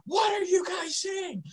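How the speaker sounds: teasing squeaky impression of a voiceless wife; mock strained wife. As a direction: imitate her straining to be heard. Imitating wife who lost her voice.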